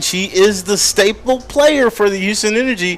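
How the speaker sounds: high, loud hype for the star; excited hype. Real, not acted. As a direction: build the crowd up, big and bright. Hyping the star player.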